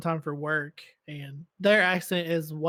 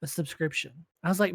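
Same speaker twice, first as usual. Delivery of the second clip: usual speaking voice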